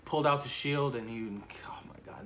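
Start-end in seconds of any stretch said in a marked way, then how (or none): 1.7-2.3 hushed, overcome whisper; awed whisper. Real, not acted. Fan recalling Cap's return, whispering in awe. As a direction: breathe "oh my God" almost silently.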